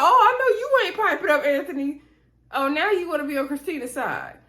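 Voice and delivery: high, mocking call-out; mock indignation. Host calling out a man for switching sides, mock indignation. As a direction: jump up high in mock disbelief, then ease down.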